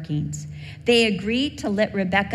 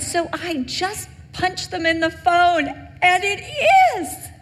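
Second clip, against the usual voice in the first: much higher, a lilting imitation of mom; imitating mom on phone; 10 semitones higher, 3 dB louder, slower than usual. Mom surprising her daughter on the phone, high imitated mom voice. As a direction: pitch way up to voice her mother's surprised call.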